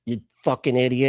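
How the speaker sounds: ordinary talk, nothing marked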